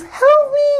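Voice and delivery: put-on squealed high kid plea through a laugh. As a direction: shriek it like a tiny boy.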